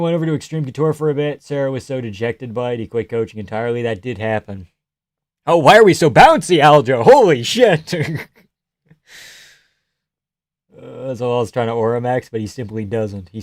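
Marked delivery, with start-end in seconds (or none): 5.4-7.9 loud, excited exclamation; loud and amazed; real loud excitement. Real, not acted. Reacting loudly to fight.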